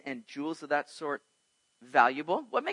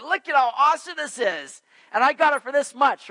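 higher, louder mock brag; bragging buyer; 9 semitones higher, 9 dB louder than usual. Buyer bragging to friends about his deal, hammed-up. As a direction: show off the bargain. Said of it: "He's like".

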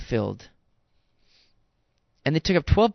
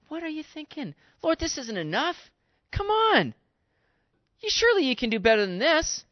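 higher, whiny, incredulous grumble; complaining disciple; 6 semitones higher than usual. Acted. Disciple grumbling to the Lord, higher and incredulous. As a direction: whine to the Lord about too little food. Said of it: "How quick would we have been to begin to complain and grumble".

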